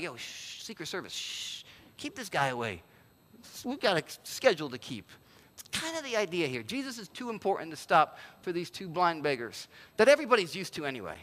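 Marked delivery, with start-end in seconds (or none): 0.0-5.1 soft urgent shushing of the crowd, half whispered; hushed shushing. Retold or imitated. Crowd shushing the beggars at the inauguration, hushed and urgent. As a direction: hiss them quiet so the president is not bothered. People hushing the blind beggars.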